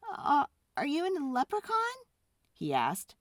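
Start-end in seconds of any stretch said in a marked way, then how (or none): 0.1-2.0 put-on higher, timid young boy; lightly voiced boy. Boy asking the tiny man, a bit higher. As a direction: ask it like a startled kid. Boy's voice in story.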